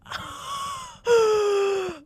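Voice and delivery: high, crying-out gasp of delight; screaming gasp. Real, not acted. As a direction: gasp as if the scene hit you. Fan gasping at movie moment.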